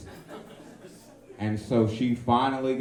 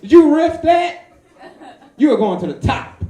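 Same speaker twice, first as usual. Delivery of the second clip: higher, louder mock praise; smug self-praise; 13 semitones higher, 11 dB louder than usual. Comic's heart praising his own dodge, high and loud. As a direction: gloat at your own quick wit.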